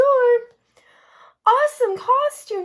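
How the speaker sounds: excited. Acted. Pete cheering in story.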